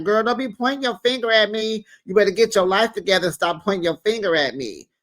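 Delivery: high, fast sassy comeback; mock clapback. Retelling Quad snapping back at Simone, fast high sass. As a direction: fire it back fast and high like a reality star. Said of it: "So Quad was like".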